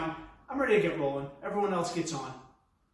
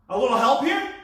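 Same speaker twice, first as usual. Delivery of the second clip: calling for help; 4 semitones higher, 8 dB louder than usual. Retold or imitated. Calling to the bus driver.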